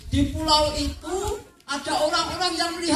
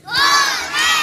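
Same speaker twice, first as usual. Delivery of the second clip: cheer; 13 semitones higher, 7 dB louder than usual. Acted. Children's cheering call-response.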